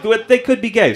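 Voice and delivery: lightly mocked open-minded parents; imitating tentative townspeople.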